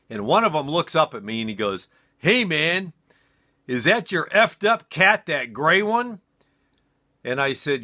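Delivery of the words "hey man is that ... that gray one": a hint of a stoned buddy, higher and a bit louder; stoned roommate. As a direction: lightly play the wasted college guy. Stoned roommate asks about cat.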